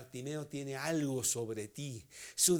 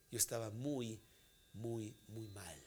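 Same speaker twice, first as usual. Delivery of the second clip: softly; 5 semitones lower, 8 dB quieter than usual. Real, not acted. Preacher confesses his low state.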